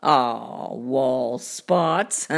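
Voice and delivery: low cartoon cat voice; deep, unbothered storybook cat; dismissive cat. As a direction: brush it off in a deep voice. Cat shrugs off the mess.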